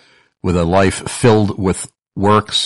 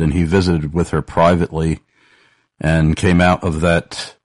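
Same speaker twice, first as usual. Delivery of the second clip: normal talking voice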